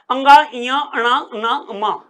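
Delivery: अंगा, इया held on the nasal; teacher sounds out the nasals; nasal consonant demo. Shown as a demonstration. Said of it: "प्रत्येक वर्ग का पंचम वर्ण".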